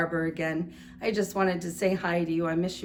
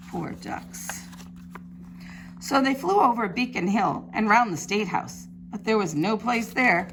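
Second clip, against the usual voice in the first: lively picture-book storytelling; reading to children; 4 dB louder than usual. A narrator's reading. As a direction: read it like storytime for little ones. Teacher reads picture book aloud.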